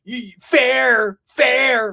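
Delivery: loud, high put-on troll voice; jeering crank caller. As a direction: jeer it down the phone, high. Prank callers' abuse acted out.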